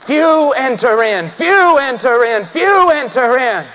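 slow, drawn-out cry with falling tune; ringing pulpit proclamation, each phrase falling away; preacher's cry. Real, not acted. Preacher cries out a repeated warning.

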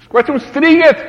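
high, loud anguished cry. Retold or imitated. Preacher voicing Jesus crying out from the cross, loud anguished cry. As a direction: cry out to heaven at full voice. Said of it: "După asta spune".

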